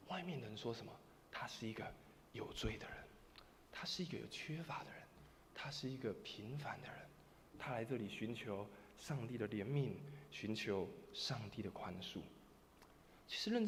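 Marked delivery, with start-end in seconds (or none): 1.3-12.2 soft, partly whispered confession; humble near-whisper. Retold or imitated. Humble mourner quietly confessing the dead man's sin. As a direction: whisper it in shame.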